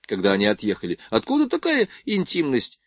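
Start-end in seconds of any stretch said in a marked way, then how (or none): 1.1-2.8 high-pitched indignant question; displeased. A narrator's reading. Varya questions the ensign's closeness to Nadya, displeased. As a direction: pitch it up, mildly put out. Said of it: "недовольно спросила Варя".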